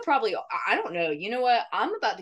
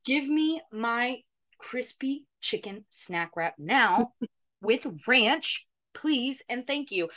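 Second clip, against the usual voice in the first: slow, higher, word-by-word demand; mock-menacing demand; 4 semitones higher than usual. Customer threatening the drive-thru worker, slow mock demand. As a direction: spell out the order as a playful threat. Acted demand for discontinued snack wrap.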